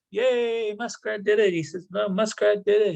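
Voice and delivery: high, joyful cheer; bright high cheer of victory. Acted. Animals cheer the found land.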